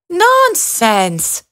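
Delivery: high, brushing-off nonsense; dismissive field mouse. A narrator's reading. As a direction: wave her refusal away like a bossy little mouse. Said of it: "said the field mouse".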